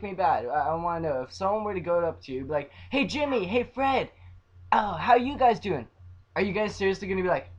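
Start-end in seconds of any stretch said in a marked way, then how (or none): 2.9-5.9 higher, louder hailing voice; excited mock greeting. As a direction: call out to them like an eager fan. Acts out a fan greeting them.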